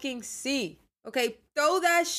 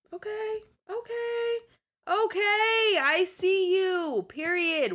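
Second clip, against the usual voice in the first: much higher, drawn-out hype for a look she loves; gushing hype; 12 semitones higher, slower than usual. Pretend. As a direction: hype up the outfit like a fan. Gushing over Pinterest fashion photos.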